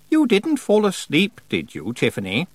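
would-be playful. A narrator's reading. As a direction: tease as if you think you sound playful. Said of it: "what she probably thought was a playful voice".

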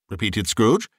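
plain reading voice

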